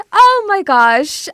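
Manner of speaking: shorter, brighter oh my gosh. Real, not acted. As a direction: toss it off quickly, still thrilled. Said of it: "look how excited I am".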